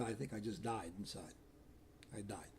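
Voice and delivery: very soft, low confession; hushed, defeated admission; retold despair. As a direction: let the voice sink, emptied out. Confesses inner collapse to rector.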